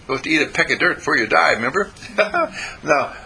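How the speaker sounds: ordinary talk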